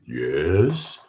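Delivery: a hint of the old radio star's deep voice; marvelous deep voice. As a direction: lightly imitate a famous radio voice. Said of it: "in this marvelous voice".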